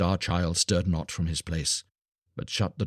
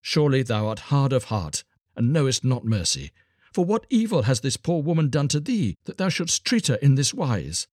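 raised, reproachful appeal; stern rebuke in a higher voice; rebuking; 7 semitones higher than usual. A narrator's reading. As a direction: scold with pleading indignation, pitched up. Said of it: "rebuked him and said".

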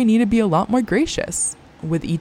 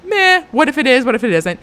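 high, loud dismissive shrug; mock careless meh; shrugging indifference; 8 semitones higher, 6 dB louder than usual. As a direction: toss it off like it barely matters. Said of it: "I'm always like".

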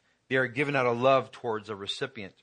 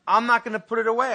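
much higher and louder, stressing not; raised insistent retold yell; insistent shout; 12 semitones higher, 12 dB louder than usual. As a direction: refuse loudly, no argument. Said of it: "I go".

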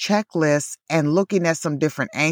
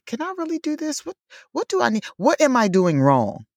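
mock-bewildered, pitched up at first; self-doubting; 10 semitones higher, faster than usual. Host voicing own doubts about travel hacks, self-doubting. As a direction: question yourself in a high, puzzled voice. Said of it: "it gives me, like, pause".

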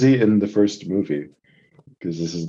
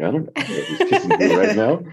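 laughing quote. Retold or imitated. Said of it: "I'm like".

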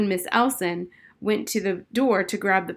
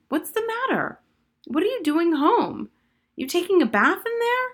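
high, raised voice of the cook through a door; cook calling; 10 semitones higher, 3 dB louder than usual. A narrator's reading. Cook knocking on the bathroom door, high and worried.

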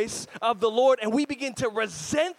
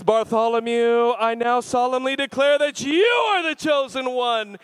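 booming solemn proclamation, much louder; solemn angel; 2 semitones higher, 9 dB louder than usual. Retold or imitated. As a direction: declare it like an angel at the bed. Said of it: "appears as an angel and says".

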